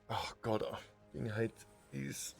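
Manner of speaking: usual speaking voice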